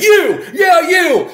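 high, loud call-out; imitated upset shout. Lady in the restaurant to waitress, imitated shout. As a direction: imitate a customer yelling at staff. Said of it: "that lady in the restaurant who said".

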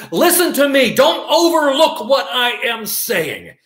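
high, emphatic call for attention; acted insistent demand. Someone trying to get their attention, acted insistent demand. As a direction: demand to be heard, stretching the end.

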